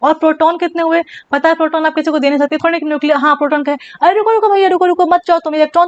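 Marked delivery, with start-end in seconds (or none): 4.0-5.9 high, hurried call to stop someone leaving; quick, raised-pitch "wait, brother" urgency; urgent mock plea. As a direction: play-act rushing in to hold someone back. Proton voiced stopping the electron.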